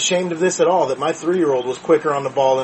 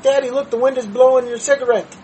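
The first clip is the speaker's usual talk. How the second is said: put-on child's voice; 7 semitones higher than usual. Three-year-old daughter voiced in story.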